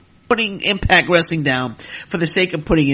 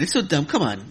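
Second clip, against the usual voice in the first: ordinary talk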